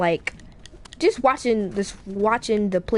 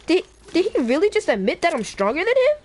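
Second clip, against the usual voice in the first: higher-pitched, swooping disbelief; incredulous inner thought; 7 semitones higher than usual. Acted. Deku in his head about Bakugo's admission, incredulous. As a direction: think it in amazement, rising sharply at the end. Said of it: "in his head, he was like".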